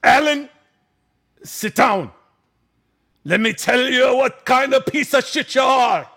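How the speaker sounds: high, loud mock tirade in a Jamaican father's voice; hammed-up angry dressing-down; pissed drunk father. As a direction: imitate a drunk dad shouting his son down. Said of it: "is pissed and drunk".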